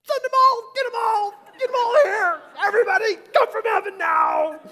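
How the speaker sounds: all-out high, very loud cry; full-force pleading shout; desperate shouted prayer. Retold or imitated. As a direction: yell to heaven for every last reinforcement. Acts out his desperate prayer.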